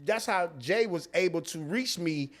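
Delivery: ordinary talk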